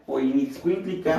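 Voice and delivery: normal talking voice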